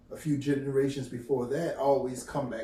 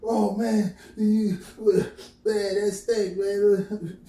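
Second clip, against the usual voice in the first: put-on higher, louder excited boyish cry; excited kids; 7 semitones higher, 6 dB louder, much slower than usual. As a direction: hype it up like a teenage kid. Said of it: "a lot of young kids used to".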